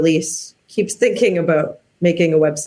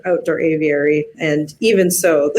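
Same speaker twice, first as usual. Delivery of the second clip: normal talking voice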